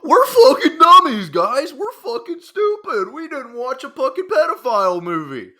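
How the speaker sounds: high, loud, mocking falsetto sarcasm; sarcastic mockery. Mocking the YouTuber who called viewers dummies, sarcastic falsetto. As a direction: throw his insult back in a silly high voice.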